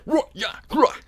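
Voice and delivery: hammed-up German gibberish; Bader. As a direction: growl out fake German syllables. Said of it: "exactly what Bader said".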